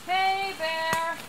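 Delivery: high call into the woods; scaring off a bear. Real, not acted. Calling out to warn bear.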